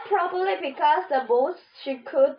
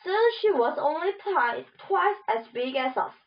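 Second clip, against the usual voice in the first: ordinary delivery, nothing marked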